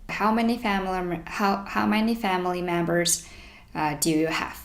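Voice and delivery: ordinary delivery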